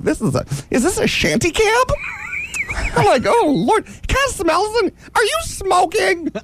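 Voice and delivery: high camp whine. Retold or imitated. Camp complaints in the cab.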